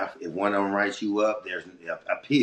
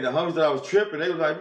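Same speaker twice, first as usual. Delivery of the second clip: ordinary delivery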